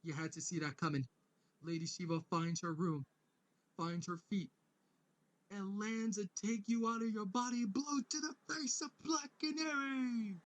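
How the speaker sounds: mock ringside announcer, rising to a big finish; fight announcer. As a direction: hype the blow like a boxing commentator. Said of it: "the announcer says".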